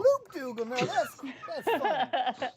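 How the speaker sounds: high, loud amused laugh-talk. Acted. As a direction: crack up at the silly name. NPC laughs at name.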